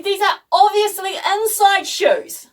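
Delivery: high, slow, emphatic insistence; indignant protest. Retold or imitated. Daughter justifying slippers to Dad, indignant insistence. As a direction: act out exasperated stating of the obvious.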